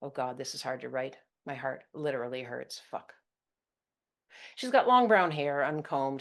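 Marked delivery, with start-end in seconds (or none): none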